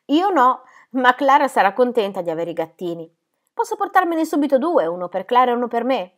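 put-on high, eager child's voice; eager little Heidi, asking. Heidi asking to take two kittens, high and eager. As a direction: plead lightly as a little girl.

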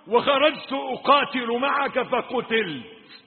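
high, loud sermon narration; impassioned storytelling. Real, not acted. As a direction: declaim the tale, building to the question. Preacher tells story at full force. (سرد وعظي جهوري)

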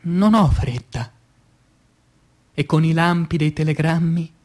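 ordinary talk

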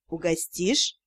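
low, soft husky ask. A narrator's reading. Investigator asking for cake, low and husky. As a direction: purr the question low and quiet. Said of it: "Низким, хрипловатым голосом".

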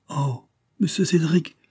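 high, slow, astonished exclamation; surprised cry. A narrator's reading. A poor woman astonished by the boy's gift, overwhelmed. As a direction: gasp out his name in joy. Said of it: "s'écria la pauvre femme, à demi suffoquée".

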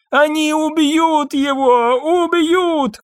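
very high, drawn-out wail; panicked screaming. A narrator's reading. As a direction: wail it hysterically, pitch way up. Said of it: "кричала Люсинда".

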